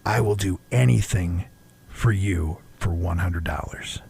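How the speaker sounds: soft, sultry, trailing into a whisper; smooth sexy voice. Retold or imitated. Woman at the bar tempting the guy, smooth and sexy. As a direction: lure him in with a low seductive murmur. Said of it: "your smooth, sexy voice".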